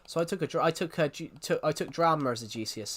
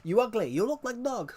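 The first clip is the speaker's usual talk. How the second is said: mock Russian accent; 7 semitones higher than usual. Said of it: "suddenly it was".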